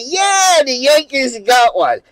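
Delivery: yelped high, then cheering; a gleeful cheer that sets off the whole bar; excited cheer. Retold or imitated.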